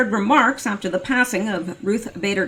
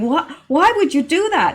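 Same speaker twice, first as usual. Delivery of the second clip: imitating a stunned woman, pitch jumping higher; stunned disbelief; 7 semitones higher, 3 dB louder than usual. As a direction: retell her shocked, teary question, voice up high. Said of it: "And this woman was crying".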